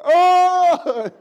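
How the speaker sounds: very high, very loud startled oh; driver's shocked cry. Retold or imitated. Preacher acting the bus driver's shocked yell. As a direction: yelp it in sudden alarm. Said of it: "the bus driver was like".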